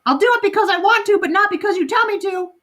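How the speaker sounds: a defiant parrot talking back; rapid high parrot retort; squawking parrot. Acted. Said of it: "Penelope squawked".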